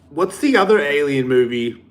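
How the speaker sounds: ordinary delivery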